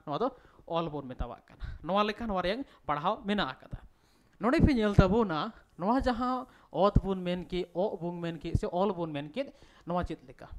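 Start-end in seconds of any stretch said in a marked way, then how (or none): none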